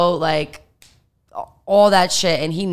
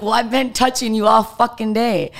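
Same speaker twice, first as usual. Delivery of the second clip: higher-pitched, exasperated retort; exasperated protest; 5 semitones higher, 4 dB louder than usual. Retold or imitated. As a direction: protest back as if it's absurd. Retold protest to the assistant.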